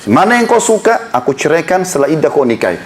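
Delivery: normal talking voice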